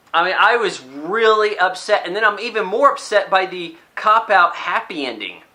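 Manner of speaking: ordinary talk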